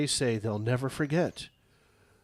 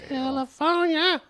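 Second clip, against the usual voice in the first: mocking drawl; 5 dB louder than usual. Real, not acted. Mock drawl of name.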